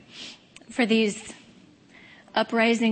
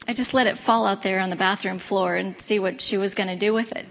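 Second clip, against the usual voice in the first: usual speaking voice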